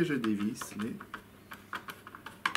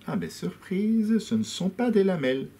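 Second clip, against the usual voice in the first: genuine surprise; 10 semitones higher, 4 dB louder than usual. Real, not acted. Opening the toy piano for viewers, surprised and delighted. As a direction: let the discovery lift your voice way up. Discovers metal rods inside toy.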